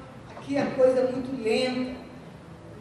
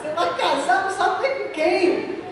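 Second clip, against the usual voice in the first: laughing; 4 semitones higher than usual. Retold or imitated. Friend teasing her, laughing.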